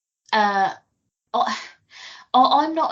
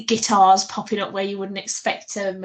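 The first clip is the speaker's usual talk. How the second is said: ordinary talk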